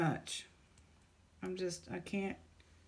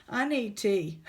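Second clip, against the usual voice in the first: louder, pleading; louder plea; 6 dB louder than usual. Real, not acted. Overwhelmed vlogger calls out loudly for tea. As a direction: call out for tea in exasperation. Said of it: "I just can't do anymore".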